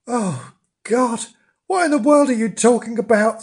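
high, quick incredulous question; surprised. A narrator's reading. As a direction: react in astonishment, pitch lifted. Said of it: "said Rosie in surprise".

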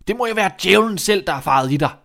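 loud, outraged accusation; angry. Acted. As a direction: rail at the wicked son. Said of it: "sagde han vredt". (vredt og højt)

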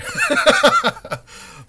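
high loud laughing burst; loud burst of laughter. Real, not acted. Big shared laugh after joke.

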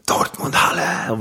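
ordinary talk

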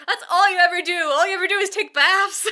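high, loud, hammed-up complaint; mock exasperated outburst. [name] complaining to Allie about her baths, hammed-up exasperation. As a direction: blow up at a friend in mock outrage.

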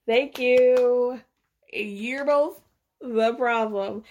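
emphatic agreement. Real, not acted. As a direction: land it like the verdict you waited for. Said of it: "finally agreeing with Louie".

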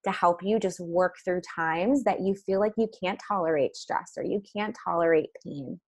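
normal talking voice

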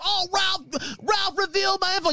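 high, nasal-sounding mock whine of a rival; whiny imitation. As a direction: mimic a crybaby complaining. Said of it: "whines about".

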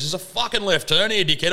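mock-alarmed passenger calling out the turn; urgent warning. Retells passenger's urgent warning shout.

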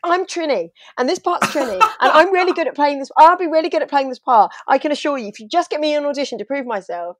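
high-pitched, emphatic self-promotion; hammed-up, pushy reenacted appeal; worked-up insistence. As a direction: plead your case hard, half mocking yourself. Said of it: "I got mad".

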